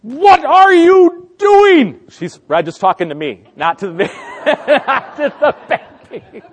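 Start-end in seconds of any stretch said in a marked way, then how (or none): 0.0-2.0 very high, loud, slow drawn-out disbelief; exasperated wife. Retold or imitated. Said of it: "and she's like". 4.1-4.8 high giggle. Real, not acted. Laughing at his own story.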